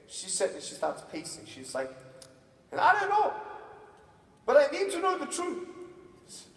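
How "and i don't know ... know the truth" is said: high, loud fretting mother; concerned mom. Retold or imitated. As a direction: imitate a worked-up mother demanding answers. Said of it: "I'm very concerned".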